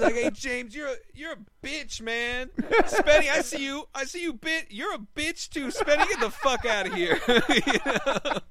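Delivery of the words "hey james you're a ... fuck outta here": put-on high, loud drunken taunting; loud drunk friend shouting insults, pitched way up; drunk yelling Seth. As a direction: bellow insults like a drunk buddy at a barbecue line. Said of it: "he basically just yells at everyone".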